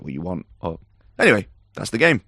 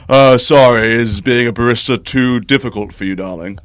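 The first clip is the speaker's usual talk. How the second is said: smug barista; 3 semitones higher, 8 dB louder than usual. Acted. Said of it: "you can hear the guy goes".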